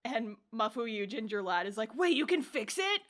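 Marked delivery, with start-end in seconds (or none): 2.0-3.0 put-on high, eager boyish voice. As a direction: ask it wide-eyed and eager. Mafuyu voiced excitedly in retelling.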